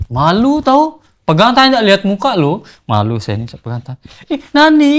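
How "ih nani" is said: tiruan cewek panik, jauh lebih tinggi dan agak keras; pura-pura jadi cewek yang kaget dan panik. Retold or imitated. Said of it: "katanya, panik". (menirukan cewek Jepang yang panik dipegang tangannya)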